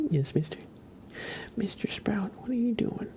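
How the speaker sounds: high cooing pet voice; pet talk. Real, not acted. Owner asking the cat what he's doing, high cooing.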